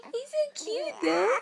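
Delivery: laughing. Real, not acted. Cooing at baby with hiccups.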